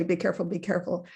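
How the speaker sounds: imitated parent, very fast and repetitive; quick mock warning. Poet imitating American mothers at playground, quick mock warning. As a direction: rattle it off like a fretting parent.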